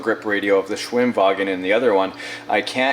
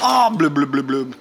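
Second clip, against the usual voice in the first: mock drowning blub blub; gurgling fish man croak; 4 semitones higher than usual. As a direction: gurgle like something sinking. Figure sinking in water.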